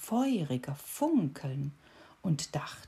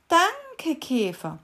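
much higher, louder bright thanks; cricket thanking; 11 semitones higher, 7 dB louder, slower than usual. A narrator's reading. Cricket repeating the thanks to the beetle, high. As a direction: chirp the thanks.

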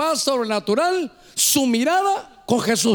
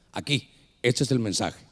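very low, softened divine voice; voice from outside; 10 semitones lower, 5 dB quieter than usual. Retold or imitated. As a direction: speak low, as a mysterious voice beside the ear. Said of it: "pero esto fue afuera".

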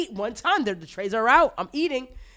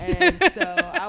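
a high, slow line broken by laughter; laughing; 9 semitones higher, much slower than usual. Real, not acted. Telling friends about skipping anesthesia, laughing. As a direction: laugh as the words come out.

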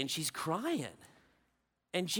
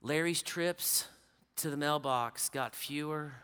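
sombre hushed narration; 7 semitones lower, 10 dB quieter than usual. Acted. Preacher narrating the deathbed visit, low and hushed. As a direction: draw the listeners into the deathbed scene.

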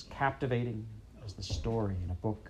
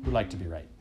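usual speaking voice

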